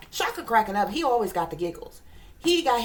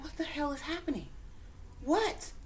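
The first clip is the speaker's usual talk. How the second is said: hushed, higher-pitched bewilderment; bewildered disbelief; 6 semitones higher, 8 dB quieter than usual. Retold or imitated. Reacting to what she said, in bewildered disbelief. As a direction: ask it softly, baffled, pitch climbing. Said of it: "I'm like".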